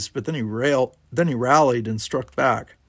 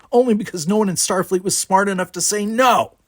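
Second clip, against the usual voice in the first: high, loud, heated comeback; angry retort; 8 semitones higher, 5 dB louder than usual. A narrator's reading. Brickar scorns Worf's place at Starfleet, angrily. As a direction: fire it back, voice raised to the end. Brickar shouts back at Worf.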